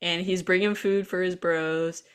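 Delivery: usual speaking voice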